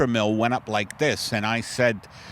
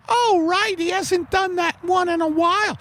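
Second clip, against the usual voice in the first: much higher put-on voice; high character voice. [name] chatting about the sketch, in a high put-on voice. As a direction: squeak it out as a prim lady.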